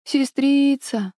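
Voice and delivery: put-on delirious child, high and drawn out; feverish. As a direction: call out slowly as if in fever. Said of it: "прошептал ребёнок в бреду". (бредящий ребёнок, высоко и протяжно)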